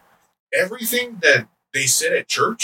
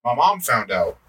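usual speaking voice

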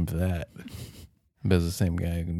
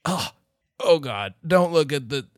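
high pitched grossed-out warning; disgusted by smell; 8 semitones higher than usual. Acted. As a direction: recoil from the filth. Acted guests in filthy room.

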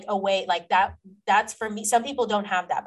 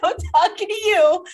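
laughing, high-pitched and loud; excitedly calling someone out; hyped up; 7 semitones higher, 6 dB louder than usual. Real, not acted. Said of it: "You're getting me hyped up".

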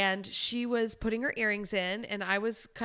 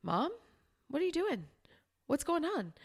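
groggy daughter; 7 semitones higher, 4 dB quieter than usual. Retold or imitated. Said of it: "she's like".

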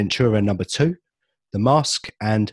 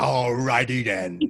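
slow drawn-out comic shout; comic catchphrase shout; slower than usual. Retold or imitated. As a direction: stretch it out like a cartoon hero. Ace Ventura catchphrase.

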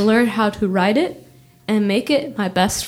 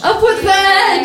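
high, slow, drawn-out mock cheer; stretched-out high chant; playful rallying cheer; 10 semitones higher, 4 dB louder, slower than usual. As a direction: echo the slogan as a high, playful shout. Echoing the slogan in solidarity.

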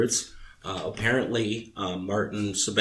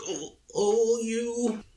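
mock exasperated woman, much higher pitch; high, drawn-out exasperated "oh, you"; Sybil Shepherd; 13 semitones higher, much slower than usual. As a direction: imitate a flustered sitcom heroine. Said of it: "I remember the exasperated Sybil Shepherd".

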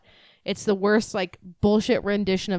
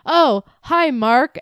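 mock movie line; 6 semitones higher, 6 dB louder, much slower than usual. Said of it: "some random person walks by".

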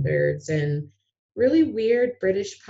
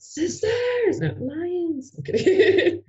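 much higher, giggling; laughing; 11 semitones higher, much slower than usual. Real, not acted. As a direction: squeal it through laughter. Joke correction, laughing.

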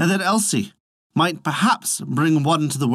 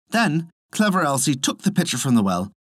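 ordinary delivery

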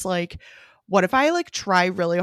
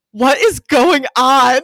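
very slow, loud, higher confused voice; bewildered boyfriend; 6 semitones higher, 9 dB louder, much slower than usual. Retold or imitated. Chris seeing her laugh and cry at once, slow bewildered. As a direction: stretch it out, baffled. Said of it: "he was like".

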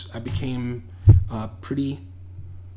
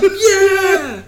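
high, loud whooped yeah; mock crowd cheer; 9 dB louder than usual. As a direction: cheer along like the grooving crowd. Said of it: "they're like".

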